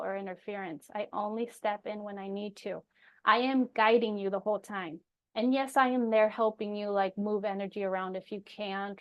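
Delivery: ordinary talk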